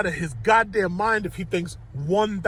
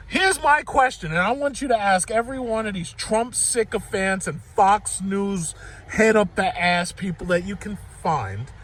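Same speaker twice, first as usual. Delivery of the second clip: usual speaking voice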